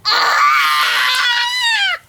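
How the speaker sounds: high loud shriek at the sour taste. Real, not acted. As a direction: shriek from the sourness.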